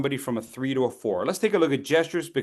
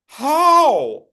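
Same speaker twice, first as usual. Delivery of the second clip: high, loud baffled how; mock baffled yell; loud baffled student; 12 semitones higher, 8 dB louder than usual. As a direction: throw up your hands and ask how. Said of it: "students' bafflement".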